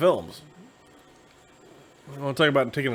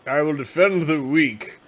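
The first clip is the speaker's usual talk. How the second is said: slow, louder hammed-up hero; heroic defending knight; 3 semitones higher, 5 dB louder, slower than usual. Knight stepping in before the mob, slow mock-heroic declaration. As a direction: declaim it slowly like a grand champion. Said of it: "and he says".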